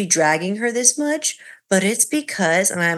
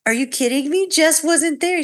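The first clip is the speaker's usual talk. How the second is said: incredulous husband; 8 semitones higher than usual. Retold or imitated. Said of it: "he's like".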